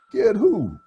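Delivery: high, loud startled "Get who?"; sudden high boy's question; surprised question. Acted. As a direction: jump in out of nowhere. Easel pops up surprising her.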